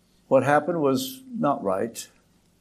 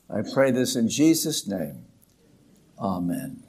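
prayer; 2 semitones lower than usual. Acted. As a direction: pray it over the congregation. Opening prayer.

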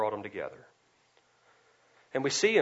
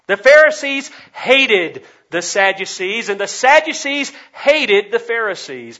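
loud, high preaching at full force; emphatic preaching; 8 semitones higher, 16 dB louder, slower than usual. Real, not acted. Preacher hammering home the rivalry to the congregation, loud and emphatic. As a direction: drive each phrase home at full voice.

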